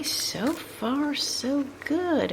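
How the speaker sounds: slow concentrating singsong; slow, drawn out. Real, not acted. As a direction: stretch it slow and high while concentrating. Concentrating while threading a needle.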